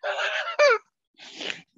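high, squealing helpless laughter; real high-pitched giggling speech; laughing to tears. Real, not acted. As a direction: talk through tears of laughter, then crack up. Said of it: "I've got tears in my eyes".

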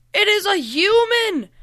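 high, loud cry of alarm; alarmed yell. A narrator's reading. Gargoyle warning the others of a human, high alarmed yell. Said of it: "yelled the gargoyle".

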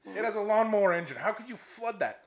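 loud, high, incredulous tirade; hammed-up outrage, firing off indignant questions; angry rant. Golfer telling off the marshal over flooding, outraged.

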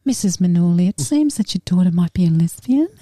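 lightly put-on doctor voice; imitated doctor. Doctor telling mum about her daughter, lightly imitated. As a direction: mimic a doctor delivering a diagnosis. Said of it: "Doctor's like".

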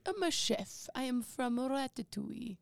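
hammed-up mock French cook from Ratatouille; a hint of a cartoon French chef, badly faked; bad French chef impression. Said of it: "that was a bad impression".

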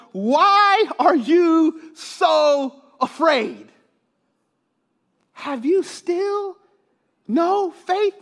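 high, very slow, drawn-out challenge; questioning Jesus. Acted. Preacher as Jesus asking the disciples, slow and challenging.

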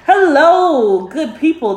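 bright high greeting to the audience; hello like opening a show; podcast intro. Real, not acted.

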